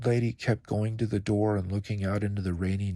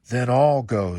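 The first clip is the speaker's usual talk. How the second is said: bold offer; 3 semitones higher, 8 dB louder than usual. Acted. Alligator volunteers boldly.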